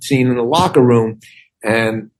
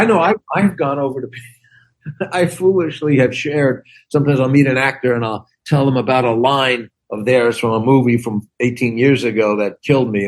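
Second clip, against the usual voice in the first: normal talking voice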